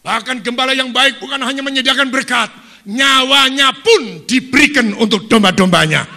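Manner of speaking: loud, high sermon cry; preaching at full force. Real, not acted. Preacher rousing the congregation, shouting. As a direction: shout it to the whole church.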